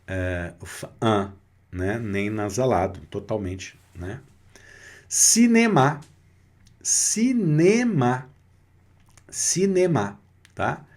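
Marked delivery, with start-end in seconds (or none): 5.1-10.2 cinema in French, stress on the last syllable, closed vowels. Shown as a demonstration. Teacher modelling French cinema for students, slow and clear. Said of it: "a tônica não é cinema".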